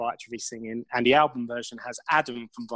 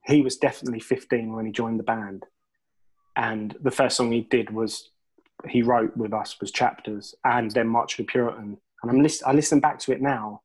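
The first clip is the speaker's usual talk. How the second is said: ordinary talk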